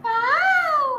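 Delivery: high, loud cooing wow; admiring wow. Real, not acted. Mother admiring the bangles on her baby, gushing.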